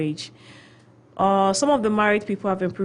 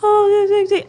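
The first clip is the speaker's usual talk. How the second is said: put-on very high, loud girly squeal; mock girly voice; 13 semitones higher, 7 dB louder than usual. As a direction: squeak it like a child mimicking girls. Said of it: "girls, they talk like this".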